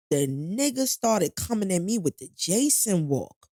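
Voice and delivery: ordinary talk, nothing marked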